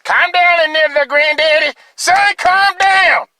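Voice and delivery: joking high voice to break the emotion; goofy high voice. Pretend. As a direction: talk yourself down in a silly high voice. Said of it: "bring in humor whenever I get a little emotional".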